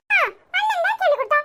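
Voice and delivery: put-on high, bright little kid asking mum; small child voice. Little child asking mother about her bus trip, high and eager. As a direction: play a tiny excited child.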